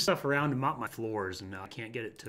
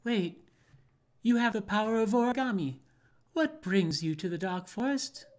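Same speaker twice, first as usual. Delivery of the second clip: high archer voice softening; curious, still in the archer's pitch; 7 semitones higher than usual. Acted. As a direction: archer noticing the origami power. Archer continues.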